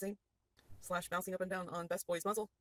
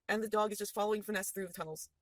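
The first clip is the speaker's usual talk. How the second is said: ordinary talk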